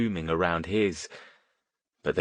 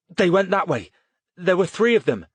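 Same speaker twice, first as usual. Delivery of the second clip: urgent; 10 semitones higher, 5 dB louder than usual. A narrator's reading. Boy tells cop, stressed.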